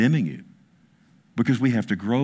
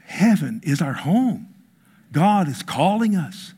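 ordinary delivery

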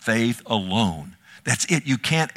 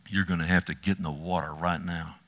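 ominous hush; 5 semitones lower, 7 dB quieter than usual. Retold or imitated. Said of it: "I don't like the way you said that".

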